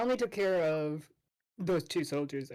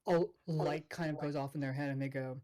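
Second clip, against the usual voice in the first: ordinary talk, nothing marked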